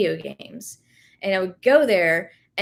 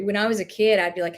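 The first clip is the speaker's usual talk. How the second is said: ordinary delivery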